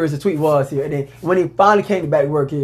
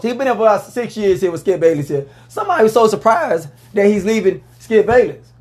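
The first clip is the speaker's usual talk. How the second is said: ordinary delivery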